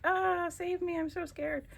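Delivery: lightly voiced high squeaky puppy; scared little puppy. Crafter voicing a collage puppy, mock scared and high. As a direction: give the cutout puppy a tiny frightened plea. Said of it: "puppy that's like".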